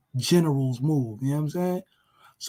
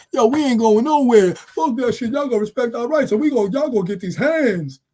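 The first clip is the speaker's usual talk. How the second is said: loud, higher defiant yell in a put-on voice; mock defiant shout; 5 semitones higher, 7 dB louder than usual. Protester refuses to disperse, mock defiant shout. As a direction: bark it back at the cops. Defiant crowd answering police.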